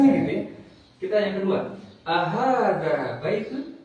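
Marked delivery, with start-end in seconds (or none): 2.1-3.8 Ahada baitun slow, rising at ahada; question tune. Shown as a demonstration. Teacher asks students if this sounds like a question. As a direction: ask Ahada baitun clearly. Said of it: "Nanya, nanya nggak?".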